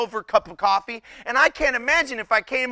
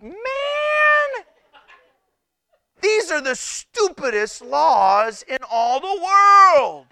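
slow, louder exasperated declaration; 7 semitones higher, 5 dB louder, slower than usual. Retold or imitated. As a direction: drag it out in mock outrage. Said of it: "I'm like".

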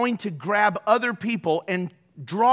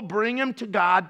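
ordinary talk

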